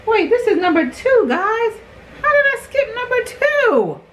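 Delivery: high-pitched shocked squeal. Real, not acted. Panics over a skipped number.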